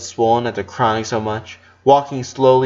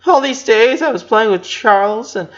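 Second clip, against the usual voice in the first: put-on higher, louder little girl pouring out regret; tearful regret; 9 semitones higher, 3 dB louder than usual. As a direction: blurt it out through tears. Lana sobbing regrets to brother.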